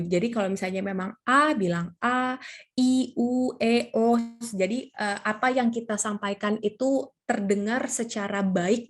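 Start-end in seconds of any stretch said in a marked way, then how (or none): 2.0-4.2 A, I, U, E, O each held open and distinct; clearly articulated vowels. Shown as a demonstration. Speaker shows audience good articulation, clearly articulated A, I, U, E, O. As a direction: say A, I, U, E, O separately, mouth unrestrained. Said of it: "jangan mulutnya ditahan".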